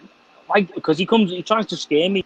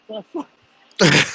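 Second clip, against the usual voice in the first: put-on slow, drawn-out, higher kid's disbelief; shocked son; 7 semitones higher, 4 dB louder, much slower than usual. Son hears about the RPG, slow higher disbelieving kid voice. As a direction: stretch it out in a child's shock. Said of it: "He's like".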